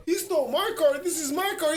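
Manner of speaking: put-on high-pitched childish complaint; tattling little kid. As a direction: imitate a kid telling on someone. Little kid accuses to mom.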